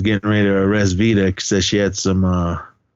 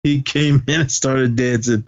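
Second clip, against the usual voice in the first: ordinary delivery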